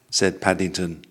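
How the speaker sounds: plain reading voice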